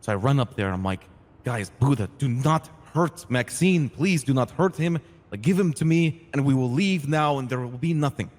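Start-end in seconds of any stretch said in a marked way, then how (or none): 1.4-8.3 higher, louder, drawn-out begging; urgent plea. Retold or imitated. Ray begging Buddha to spare Maxine, urgent. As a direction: plead firmly, leaning on every word.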